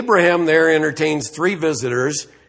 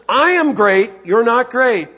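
raised, emphatic declaration of superiority; put-down; 6 semitones higher, 5 dB louder than usual. Acted. Preacher voices God humbling Job, emphatic put-down. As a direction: put him in his place, high and loud. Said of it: "he just puts him down".

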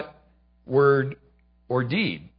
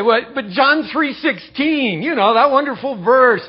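objecting listener; 6 semitones higher, 6 dB louder than usual. Retold or imitated. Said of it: "Well, you say".

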